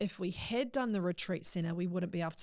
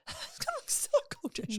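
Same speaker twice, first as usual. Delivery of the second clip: laughing. Real, not acted. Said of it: "You sign Scientology".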